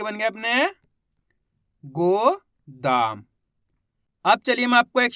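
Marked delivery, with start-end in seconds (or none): 1.8-3.2 गोदाम stretched very slowly and low; गोदाम said very slowly as a final reveal; drawn out word. Shown as a demonstration. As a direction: draw out गोदाम, every sound long. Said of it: "दाम बोलेंगे".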